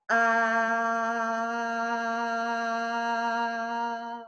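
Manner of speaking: one long, even "A" held on the exhale; sustained vowel on the breath. Shown as a demonstration. Yoga teacher demonstrating the vowel breath to children, long steady "A". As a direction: let the whole breath out on a steady "A". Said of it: "C'était magnifique".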